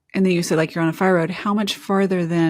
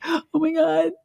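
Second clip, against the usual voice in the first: retold gasp; 10 semitones higher than usual. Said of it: "I'm like".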